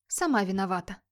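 usual speaking voice

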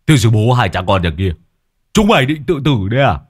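angry curse at the riders; cursing. A narrator's reading. Old man cursing the riders who blocked the road, angry. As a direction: bark at the reckless pair. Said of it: "thò mồm ra chửi".

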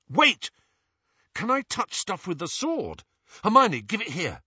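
high, quick burst of idea; sudden excitement. Acted. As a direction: blurt out the idea.

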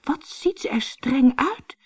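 put-on high sighing girl's voice. A narrator's reading. Anneke sees how strict the witch looks, high sigh. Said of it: "zuchtte Anneke".

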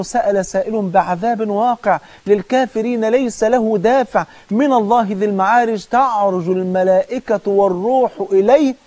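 slow melodic chanted recitation; Quran recitation. Acted. Preacher reciting the verse slowly and melodically.

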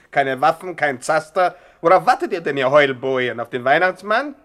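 loud scolding rant; furious gangster boss; angry boss. Acted. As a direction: bark orders at the idle gang. Boss scolding gang loudly.

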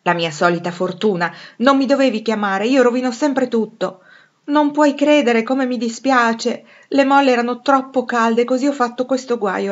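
loud, rueful self-blame; lamenting. A narrator's reading. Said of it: "lamentava la povera Jo".

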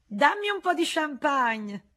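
high, slow, coquettish demand; Rosanette's voice. Acted. Rosanette asks Frédéric for champagne, high and coquettish. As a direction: voice her as a flirtatious courtesan asking. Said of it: "disse Rosanette".

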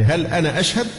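أشهد rising at the end as a question; question tune. Shown as a demonstration. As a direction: ask هل أنا أشهد, lifting the last word. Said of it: "فتصبح استفهام". (نبرة استفهام في «أشهد»)

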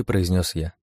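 usual speaking voice

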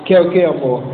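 put-on teen boy dropping his voice low to impress; mock-manly low voice for his girlfriend; a deepened, showy boyfriend voice; put-on deep voice. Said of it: "Rapaz, a voz ficou grossa".

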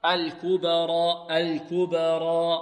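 الكبرى with final alif held two beats, very slow; two-count alif. Shown as a demonstration. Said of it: "نقف على الألف بمقدار حركتين".

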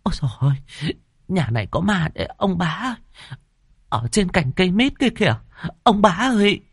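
high, quavering frightened wail; crying out. A narrator's reading. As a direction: wail to the husband in panic. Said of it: "Bà Hai kêu lên".

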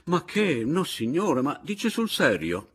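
high, loud incredulous questioning; candid amazement. A narrator's reading. Anselmo asked to act as second, amazed and incredulous. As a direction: wave it away in astonished disbelief. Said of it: "esclamò candido e stupito il signor Anselmo".

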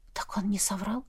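hushed, soft realisation; barely voiced, shaken question; stunned whisper. A narrator's reading. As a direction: whisper it to yourself in shock. Shaken narrator realising the truth.

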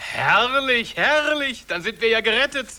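rising, overdone glee; gushing delight. Acted. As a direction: gush over the good news. Schemers realise they are saved.

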